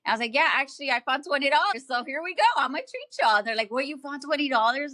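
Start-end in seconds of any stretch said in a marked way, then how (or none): 0.3-3.4 high-pitched, bubbly retelling of good news; upbeat, higher-pitched mock reply to friends; excited announcement. 3.7-4.9 high-pitched imitated disbelief; mock-amazed question; retold surprise. Acts out friends' disbelief.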